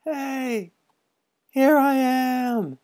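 put-on a small sick boy calling across the ravine; frail cry. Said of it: "cried a frail voice".

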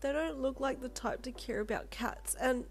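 high, plaintive complaint; upset child. A narrator's reading. Upset child tells Lolita about the couple, plaintive. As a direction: explain it slowly in a small, hurt voice.

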